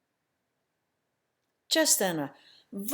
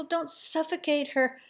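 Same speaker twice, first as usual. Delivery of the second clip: anxious plea; 6 semitones higher than usual. Acted. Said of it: "I want to cry out".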